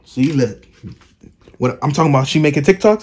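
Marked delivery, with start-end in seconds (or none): none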